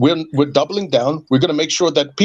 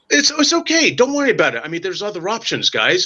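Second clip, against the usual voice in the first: high, smug brush-off executive voice; mock a boss waving away the poor; imitating a condescending exec, pitched high; mock Doug Bowser; 3 semitones higher than usual. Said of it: "Doug Bowser said".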